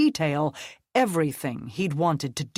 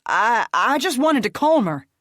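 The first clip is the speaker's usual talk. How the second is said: high, loud stammering plea; panicked sputter; 8 semitones higher, 7 dB louder than usual. Acted. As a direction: stumble over the words in panic. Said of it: "Reed sputtered".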